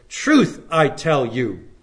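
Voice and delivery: "Truth" slow and weighty at the front, each word spaced out; stress on truth. Shown as a demonstration. Preacher stressing Truth for his congregation, slow and weighty. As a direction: lean hard on "Truth", then slowly "I tell you". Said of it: "he emphasizes the word truth".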